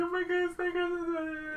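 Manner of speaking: high-pitched hammed-up sobbing; fake crying. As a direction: fake a whiny cry. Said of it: "Stop fake crying".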